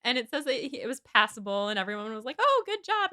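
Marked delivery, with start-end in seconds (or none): 2.4-3.1 high-pitched gushing congratulation; mock praise. Party crowd complimenting a modest singer, gushing. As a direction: gush it brightly, far up in pitch. Everyone praising Frank's singing.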